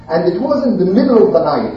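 usual speaking voice

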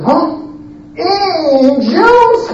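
high-pitched disbelief; astonished. Acted. Prophet learning his guests are angels, astonished. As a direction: gasp out the question in amazement.

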